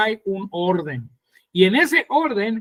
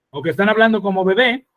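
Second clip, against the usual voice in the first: ordinary talk, nothing marked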